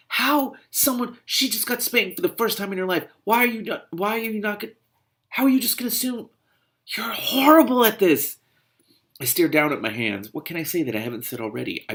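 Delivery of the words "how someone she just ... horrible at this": high-pitched, stumbling exasperation; splutter, then blow up at the end; outraged scolding. Real, not acted. Reader scolds the man over her first spanking, outraged.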